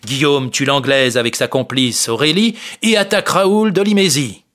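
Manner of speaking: ordinary delivery, nothing marked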